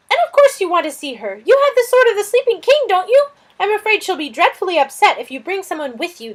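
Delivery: high, loud, fretful lizard voice; a flustered little lizard fretting over a guest; fussy lizard. Acted. Lizard Suz fussing about the stranger, high and loud.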